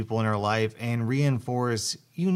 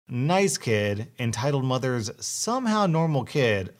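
usual speaking voice

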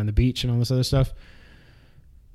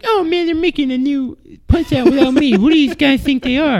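high, hammed-up indignant boxer voice; squeaky mock-offended rant; Mike Tyson impression; 12 semitones higher, 3 dB louder than usual. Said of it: "He's like".

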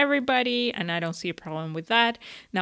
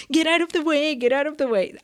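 higher, louder, whiny teen mockery; whiny teenager voice; 7 semitones higher, 4 dB louder, faster than usual. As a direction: whine it like a bratty teenager. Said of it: "a really whiny voice, like a teenager".

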